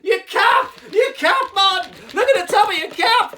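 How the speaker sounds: very high, full-volume yelling; frenzied, hammed-up excitement; overexcited puppet. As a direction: shriek the discovery at top volume. Puppet overexcited at spotting another circle.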